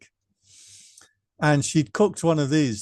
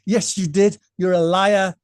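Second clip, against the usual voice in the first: higher mock scolding mother; retold angry mother; 5 semitones higher, 4 dB louder than usual. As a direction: replay mom calling him a liar, half-yelling. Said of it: "She said".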